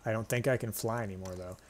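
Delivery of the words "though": ordinary delivery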